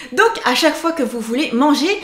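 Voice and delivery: ordinary delivery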